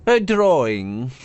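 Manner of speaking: high, scornful magical blade; haughty sword voice. Acted. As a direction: sneer down at him from above. Sword dismisses Ren.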